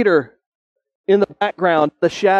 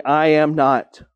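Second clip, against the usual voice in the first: a slow I am not, drawn out; slow denial; slower than usual. Acted. Peter denying Jesus a third time, slow and drawn out. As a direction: hold each word of the denial. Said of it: "He denied it, saying".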